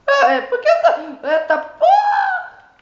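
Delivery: high panicked yell with laughter; panicked laughing shout. Real, not acted. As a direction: scream and laugh at once. Attacked by a creature.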